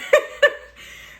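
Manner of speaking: high unvoiced burst of laughter. Real, not acted. Laughing at the rude lady, high breathy laugh. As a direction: laugh in disbelief.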